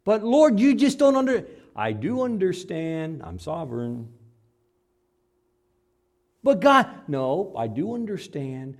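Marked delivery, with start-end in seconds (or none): 0.0-1.5 whining believer. Acted. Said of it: "whining believer objects". 6.4-7.1 whining believer. Acted. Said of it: "whining believer".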